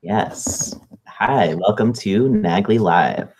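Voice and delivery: ordinary talk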